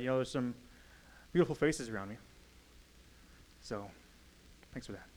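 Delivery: soft, hesitant confession; quiet inner thoughts. Acted. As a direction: murmur it like a private prayer. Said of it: "I'm going to express my thoughts and feelings".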